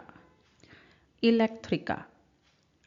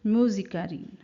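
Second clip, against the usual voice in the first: normal talking voice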